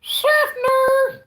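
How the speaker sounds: very high, loud mock cry; exclaimed town name. Shouts the town's name.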